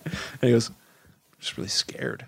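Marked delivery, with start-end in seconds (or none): 1.4-2.2 whispered, voiceless timid reply; imitating scared whisper. To his friend in the pool, a scared whisper. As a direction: hush it like a frightened kid. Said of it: "I scared him by even asking".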